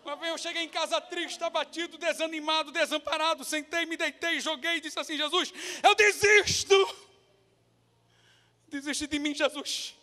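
high-pitched, emotional preacher's voice peaking in a cry; impassioned testimony. Real, not acted. (testemunho emocionado, em voz aguda)

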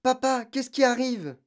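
startled and urgent; alarmed question. A narrator's reading. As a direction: call to your father in alarm. Girl alarmed at parents' frantic packing.

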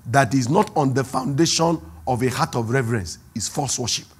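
ordinary talk

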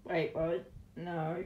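ordinary delivery

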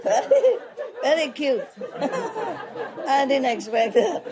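words squeezed out through real laughter; laughing. Real, not acted. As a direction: talk while still cracking up. Laughing at the joke's punchline.

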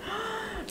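sudden startled gasp; sharp gasp. Real, not acted. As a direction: gasp in alarm. Phone gets splashed with juice.

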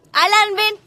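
loud, high call across the room. Real, not acted. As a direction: shout for him to come over. Calling her brother over. (llamando en voz alta)

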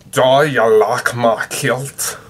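loud, slow Scottish accent with broad vowels; Scottish "Do you like my kilt?" as the translator says it. Shown as a demonstration. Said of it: "with the English to Scottish translator, it tells me".

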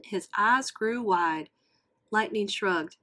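ordinary delivery, nothing marked

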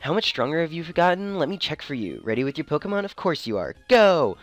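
put-on bright valley girl voice, shout on Go; bubbly rival in valley girl voice. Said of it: "Valley Girl voice".

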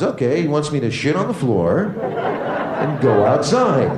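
slow, dim-witted dog voice; be a dog cheerfully getting it wrong, slowly; dog slowly misreading its owner's scolding; dopey dog. Acted. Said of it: "The dog goes".

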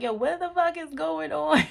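high, loud, incredulous exclamation; mock disbelief. Reacting to their own recorded voice, loud mock disbelief. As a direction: blurt it high and loud, half laughing.

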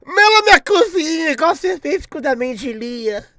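high, slow mock-whiny voice; mocking himself in the clip. Streamer mimicking the clip chat spams of him, high mock-whiny. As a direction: parody how he sounds in the clip. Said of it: "aquela porra daquele clipe de eu falando".

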